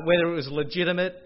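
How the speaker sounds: normal talking voice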